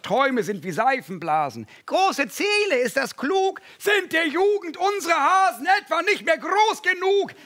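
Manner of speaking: high nagging mosquito chorus; mocking mosquitoes. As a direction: squeak it out like a crowd of sneering gnats. Other mosquitoes mock Edward.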